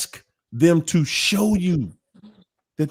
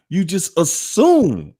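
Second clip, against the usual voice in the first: usual speaking voice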